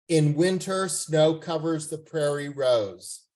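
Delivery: ordinary talk, nothing marked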